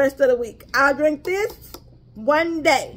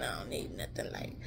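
whisper; 20 dB quieter than usual. Real, not acted. Repeating to viewers she won't drink light teas, whispered. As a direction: drop to a secret whisper on "light".

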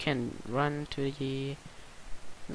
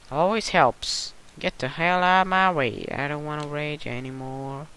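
ordinary talk

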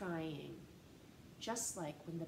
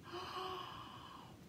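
soft gasp; 8 semitones higher, 8 dB quieter than usual. Acted. Reader reacts to caps falling.

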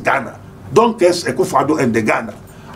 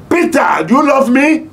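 rising shout; 7 semitones higher than usual. Retold or imitated. Preacher voicing Jesus asking Peter, in a high shout.

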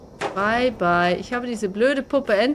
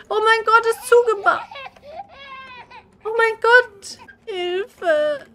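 high, loud, near tears; frightened; 8 semitones higher, 4 dB louder than usual. Real, not acted. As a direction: panic at being trapped. Trapped, way is blocked. (verängstigt, den Tränen nah)

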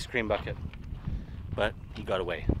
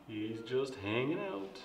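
normal talking voice

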